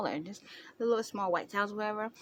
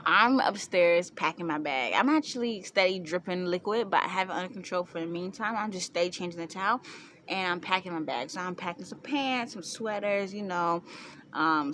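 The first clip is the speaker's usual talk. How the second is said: normal talking voice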